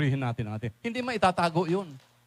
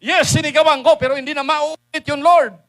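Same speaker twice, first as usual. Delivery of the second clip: higher, louder pleading to the Lord; earnest shouted vow; loud confession; 6 semitones higher, 9 dB louder than usual. Pretend. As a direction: cry out your confession to God. Voicing a repentant believer to God.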